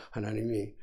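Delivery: normal talking voice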